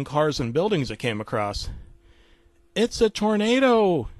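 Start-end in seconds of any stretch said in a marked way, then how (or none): 2.7-4.1 slow, high, loud cry of alarm; alarmed yell. Acted. Tim warning Moby of the tornado, a drawn-out high yell. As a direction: shout the warning with pitch raised high. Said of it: "yelled Tim".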